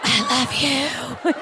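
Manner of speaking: slow, breathy-free drawn-out I love you; mock lovestruck. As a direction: melt into it like a smitten lover. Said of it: "And I'm like".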